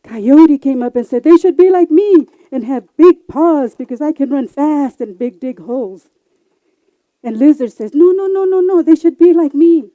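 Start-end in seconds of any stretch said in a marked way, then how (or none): 1.2-6.1 loud, high, bragging coyote; boastful coyote. Acted. Coyote boasting to the animals about his paws. 7.9-9.9 high, loud protest; no no no, push back hard; arguing lizard. Acted. Lizard loudly objecting to coyote at the podium.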